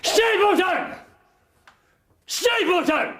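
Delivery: calling into the night at full voice; shouting a name. Acted. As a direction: yell the name into the distance.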